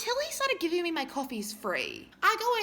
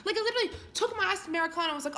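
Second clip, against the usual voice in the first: normal talking voice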